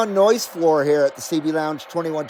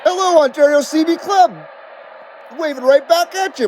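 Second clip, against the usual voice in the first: cheery greeting; 8 semitones higher, 3 dB louder than usual. Real, not acted. Greeting the club on air.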